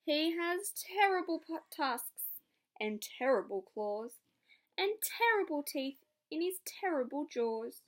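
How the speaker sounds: spooky mouse. Acted. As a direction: make the monster sound awful. Mouse describes the Gruffalo.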